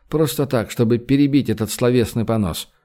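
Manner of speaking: usual speaking voice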